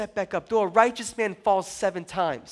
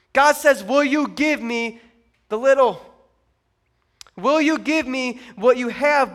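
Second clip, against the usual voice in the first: loud, driving sermon delivery; emphatic preacher, hammering key words; forceful preaching; 4 semitones higher, 8 dB louder than usual. Real, not acted. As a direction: preach it out, voice raised.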